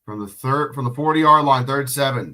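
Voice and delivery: ordinary delivery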